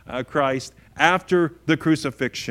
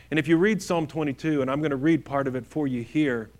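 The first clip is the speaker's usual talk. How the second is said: usual speaking voice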